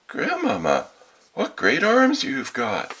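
put-on high, slow childlike wonder; greatly amazed. A narrator's reading. Girl seeing grandmother in her nightclothes, greatly amazed. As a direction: marvel at it in a little-girl voice. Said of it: "being greatly amazed".

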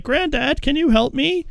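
pleading high voice. Retold or imitated. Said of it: "then is like".

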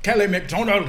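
loud, high mock Scottish; badly faked Scottish accent, belted out; failed Scottish accent. Trying the redhead movie voice, bad Scottish accent. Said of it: "somebody in that can do that voice".

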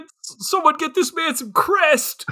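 high-pitched comic outcry; mock desperate plea. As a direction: cry out in exaggerated desperation. Mock outcry over his teeth.

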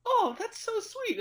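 high, slow syrupy fake sweetness; mock sweet. Villain mock-cooing at Colossus before punching him, high and syrupy. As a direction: coo it like a sarcastic villain.

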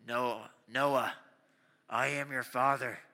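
low, loud mock-villain voice; deep, ominous call from hiding; Darth Vader. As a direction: prank a brother in a deep, booming voice. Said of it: "My Darth Vader voice".

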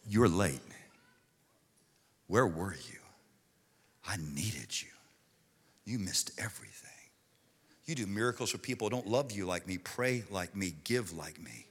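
low, hushed voice of a grieving sister; soft, low acted heartbreak; hurt reproach. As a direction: quietly accuse him, wounded, voice sinking almost to a whisper.

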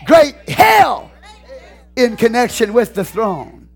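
slow, high shouted list; preaching. Acted. Pastor listing the signs from the throne, thunderous preaching. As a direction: punch each item out with a long pause between.

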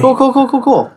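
high excited rapid-fire repetition. Real, not acted. Player reacting to becoming a hummingbird, excited. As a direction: squeal it in delighted approval.

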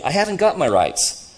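loud, high protest of an indignant man; aggrieved ghost. Acted. Ghost insisting on his rights to the other, aggrieved. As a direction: complain hotly of being cheated.

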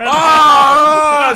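sudden loud shout. Real, not acted. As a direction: yell it out high. Outburst at network.

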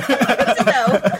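stretched out slowly with a laugh; wry slow echo of the same line; slow drawn-out repeat. Retold or imitated. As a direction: drag the words out, amused.

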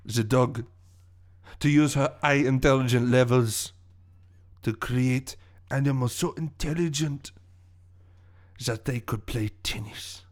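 villain monologue in a put-on French accent; accented villain revealing his master plan. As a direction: savour your revenge scheme, with pauses.

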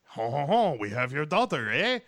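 playful imitation of Jean Reno going Canadian; mock Canadian villain. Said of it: "he's like".

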